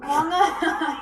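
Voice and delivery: a high, drawn-out Long Island "Long Island"; hammed-up Long Island accent. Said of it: "We as Long Islanders say things very differently".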